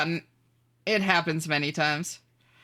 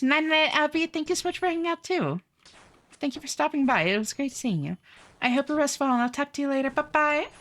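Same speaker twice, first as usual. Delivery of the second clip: bright high-pitched streamer greeting, much higher than usual; 9 semitones higher than usual. Acted. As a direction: chirp a cheerful hello to chat in a high voice. High goodbye to viewer.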